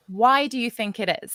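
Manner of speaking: ordinary delivery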